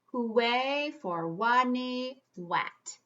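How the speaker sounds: slow, drawn-out title reading; proud slow announcement of the book title; announcing the title. Shown as a demonstration. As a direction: stretch out the title like a big reveal. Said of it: "I'm gonna read a book today".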